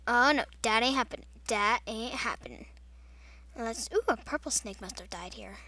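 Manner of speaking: usual speaking voice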